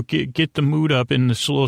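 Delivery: normal talking voice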